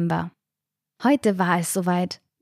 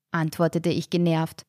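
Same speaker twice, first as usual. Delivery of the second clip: ordinary delivery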